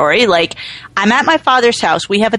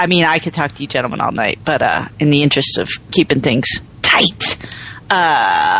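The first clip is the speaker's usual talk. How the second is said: low, quick, mock-suave host; smooth announcer voice; 9 semitones lower, 3 dB quieter than usual. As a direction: lay on the smooth charm. Mock gentlemanly sign-off.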